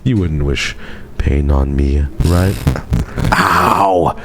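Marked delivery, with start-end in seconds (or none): 3.3-4.1 mock ow. Pretends to be hurt.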